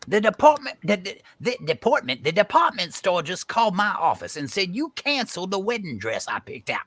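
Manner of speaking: high-pitched, stammering outrage; worked-up, tongue-tripping complaint; sputtering anger. Acted. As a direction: stumble over the words in fury. Enraged, stumbles over his complaint.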